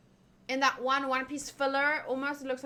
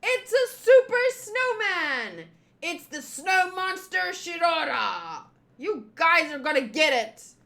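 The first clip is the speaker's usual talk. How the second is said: boisterous boasting; 6 semitones higher, 6 dB louder than usual. Acted. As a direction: announce a mighty snow monster at full voice. Kids boasting over giant snow monster.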